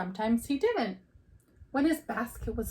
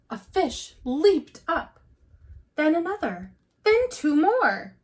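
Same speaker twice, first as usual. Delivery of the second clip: higher and louder with each fish; building excitement; 5 semitones higher, 5 dB louder than usual. A narrator's reading. Reading to kids as fish leap, growing excited. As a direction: let it climb, fish by fish.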